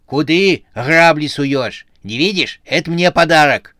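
grumpy, possessive squabbling; quarrelsome. A narrator's reading. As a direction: fend off the grabbing hands crossly. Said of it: "сварливо сказала она".

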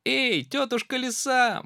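high, loud hail; mouse shouts. Acted. Mouse hailing the fox, loud and high. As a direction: call out to the fox. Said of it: "мышонок закричал".

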